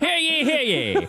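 high, slow crier call; town crier. Pretend. Hologram in town square.

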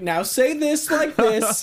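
slow, high coaching voice; imitating Chadwick. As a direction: imitate a dialect coach, slowly. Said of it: "it's just Chadwick, like".

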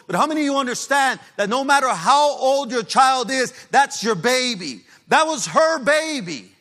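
high, full-force preacher's cry; testimony at an anguished, sustained peak; impassioned shouting. Real, not acted. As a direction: shout it in anguish, stretching the key words.

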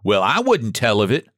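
ordinary delivery

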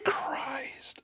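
breathy whisper, slow; whispered exasperation. Real, not acted. Player groaning at his own joke in a whisper. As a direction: sigh the words out under your breath.

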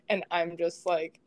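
ordinary delivery

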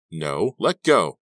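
slow, loud, panicky refusal; firm protest with panic leaking through; suppressed panic. Acted. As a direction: try to hold the panic down. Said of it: "She tried not to let her panic sound in her voice".

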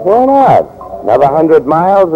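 high, bright cheerful question. Acted. Captain cheering up his gloomy lieutenant, high cheerful question. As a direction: toss it off with high spirits.

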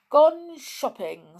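slow, high bear voice; lightly voiced gentle bear; father bear voice. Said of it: "said the father bear".

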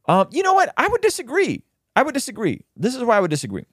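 squeaky mock rebuttal voice; mock high voice. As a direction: pitch way up, play it silly.

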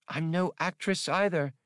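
a light touch of the alien co-pilot, a bit pointed; lightly voiced Atwari. A narrator's reading. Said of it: "she reminded him".

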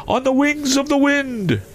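very high, wailing phantom voice; mournful ghost. A narrator's reading. Ghost refusing comfort to his old partner, mournful high voice. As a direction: intone it high as a restless spirit. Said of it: "replied the ghost".

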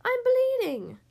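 very high, loud yelp. A narrator's reading. Fox coming to after extraction, loud yelp. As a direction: cry out in high alarm. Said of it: "the fox yelped".

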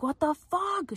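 high-pitched mock exclamation; imitated yelling. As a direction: retell her crying out in disbelief. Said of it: "she was, like, yelling".

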